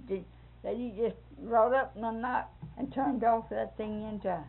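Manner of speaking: usual speaking voice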